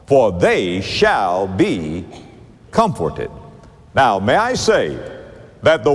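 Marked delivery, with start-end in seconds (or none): 0.0-3.3 each word slow and weighed; slow it right down, let each word land; slow emphatic reading. Shown as a demonstration. Preacher urging notice of verse four, slow and weighty. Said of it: "notice especially now verse four".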